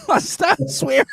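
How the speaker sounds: high, squeaky laughing words; laughing hard. Real, not acted. Host admitting his on-air blooper, laughing hard. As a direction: squeeze it out through real laughter.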